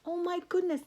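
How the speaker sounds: plain reading voice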